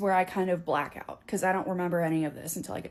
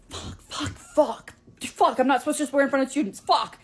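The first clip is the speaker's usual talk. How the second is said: panicked swearing; 6 semitones higher, 5 dB louder than usual. Retold or imitated.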